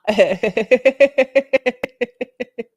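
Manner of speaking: long, high, loud giggle; rapid-fire helpless laughter; cracking up. Real, not acted. Streamer laughing at the cringe.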